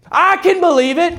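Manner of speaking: high, rising exclamation of faith; acted high-voiced affirmation; excited belief. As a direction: pitch it up high, exultant. Emphatic answer to good news.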